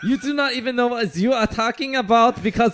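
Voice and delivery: high-pitched mock-German berating; German accent. German hotline pro berating the caller, German accent. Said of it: "do it in a German accent".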